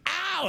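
high sharp mock ow; mock yelp of pain. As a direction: yelp it, half joking. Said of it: "you're like".

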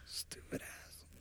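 whispered insult under the breath; whispering. Pretend. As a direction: hiss it so nobody hears. Whispered insult after imagined prank.

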